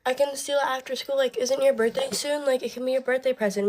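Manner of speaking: high mock girly chatter; imitating a girl. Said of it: "The other girl was like".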